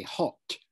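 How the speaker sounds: "hot" with a clearly released final T; Queen's T. Shown as a demonstration. Teacher showing how the Queen says it, "hot" with crisp T. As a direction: sound the T at the end of "hot". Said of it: "as the Queen would say it".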